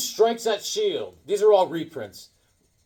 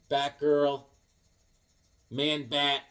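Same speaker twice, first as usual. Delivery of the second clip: ordinary talk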